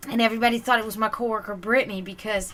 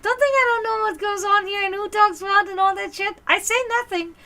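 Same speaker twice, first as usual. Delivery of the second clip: much higher, louder mock cook voice; imitating Ramon; 10 semitones higher, 4 dB louder than usual. Ramon warning her he hears everything, high hammed-up voice. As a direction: ham up the knowing cook. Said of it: "it's like".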